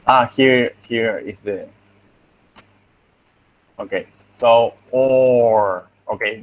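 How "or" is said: "or" long and full, with a clear o vowel; proper pronunciation. Shown as a demonstration. Teacher showing students the proper "or", full and drawn out. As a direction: stretch "or" out, keeping the vowel unreduced. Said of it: "This is the proper pronunciation".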